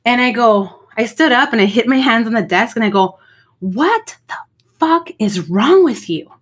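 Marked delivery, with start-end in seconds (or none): 3.6-6.3 higher, slow, pointed scolding of a teacher; retold outburst at teacher. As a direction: slam the desk and lay into her, word by word. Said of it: "I went off on her".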